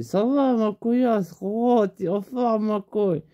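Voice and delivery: high, bouncy buddy voice; mock high-pitched pal greeting; Roro's voice. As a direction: pitch it way up like a hyped friend. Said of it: "Je fais".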